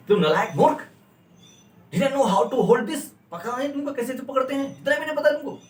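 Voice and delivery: high, scornful telling-off; angry scolding. Shown as a demonstration. Teacher acts out a boss scolding a clumsy worker angrily. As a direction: berate someone who fumbled it. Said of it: "इतना गुस्से में बोलेगा".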